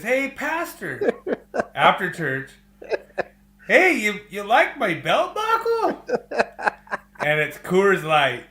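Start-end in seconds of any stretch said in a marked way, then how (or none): 0.0-1.1 put-on kid's voice. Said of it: "and he says". 3.7-6.0 put-on higher, louder boastful kid voice; proud kid. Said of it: "and he says".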